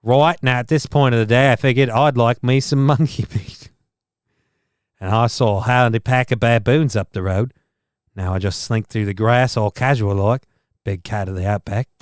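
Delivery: put-on low Australian accent, as a big cat. Leopard telling of his monkey hunt, in an Australian accent. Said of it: "Why are you doing an Australian accent?".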